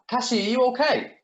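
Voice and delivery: high and loud, frightened for her; panicked call. Acted. Calls out after hearing a scream.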